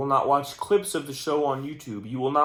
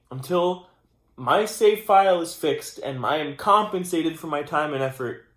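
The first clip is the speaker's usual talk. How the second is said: raised voice, pressing the demand hard; loud demand; 4 semitones higher, 6 dB louder than usual. Real, not acted. Vlogger demanding his save file be fixed, loud and insistent. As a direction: lean in and push the words out louder.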